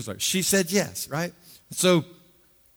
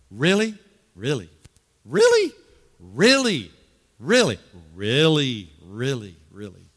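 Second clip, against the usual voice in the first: put-on teenager's "really"; 6 semitones lower than usual. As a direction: try each "really" in a different teenage tone. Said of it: "that word that your teenager uses".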